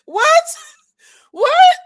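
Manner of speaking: very high, loud shrieked what; shocked what. Real, not acted. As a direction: shriek it in utter disbelief. Disbelieving outcry.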